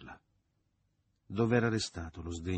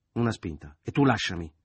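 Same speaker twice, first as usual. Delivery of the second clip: louder forceful demand; struggling order; 3 semitones higher, 8 dB louder than usual. A narrator's reading. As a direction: order him off while struggling. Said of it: "ingiunse dibattendosi". (ordine secco, dibattendosi)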